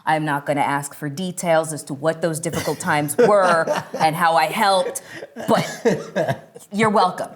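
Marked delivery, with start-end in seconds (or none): 5.5-7.3 slow, laughing wry aside; laughing teasing welcome. Real, not acted. Joking aside to vets.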